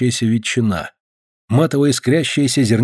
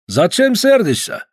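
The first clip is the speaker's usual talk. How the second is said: put-on slow, higher, drawn-out Caucasian accent; 10 semitones higher, slower than usual. Georgian guest chides the late host, slow Caucasian accent. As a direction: stretch each word with a heavy Caucasian accent.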